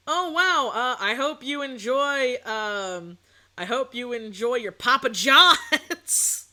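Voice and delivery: drawn-out mock well-wishing; sarcastic taunt. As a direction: stretch it out slowly, building to a loud finish. Sarcastic boycott taunt to friends.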